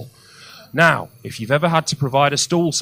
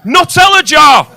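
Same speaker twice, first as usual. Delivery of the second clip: much higher and louder, sarcastic delight; mock-amazed shout; 12 semitones higher, 10 dB louder, slower than usual. Real, not acted. Comic mocking a patient's Nutella jar, sarcastic shout.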